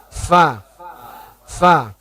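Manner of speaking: "Fa" ringan, hanya sentuhan bibir; light fa. Shown as a demonstration. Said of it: "Dikit aja". ("Fa" ringan saja)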